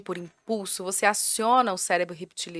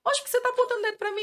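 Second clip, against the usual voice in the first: put-on high, fast little-kid voice; child's outraged question, voiced high and quick; imitating a child's protest; much faster than usual. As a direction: squeak it out fast like an indignant child.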